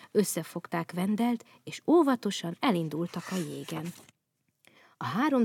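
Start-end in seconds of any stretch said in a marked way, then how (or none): none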